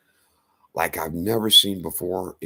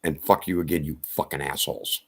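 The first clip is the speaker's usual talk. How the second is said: ordinary talk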